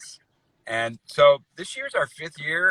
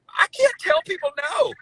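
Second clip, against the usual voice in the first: high pleading; 4 dB louder than usual. Retold or imitated. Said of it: "I said".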